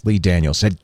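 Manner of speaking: usual speaking voice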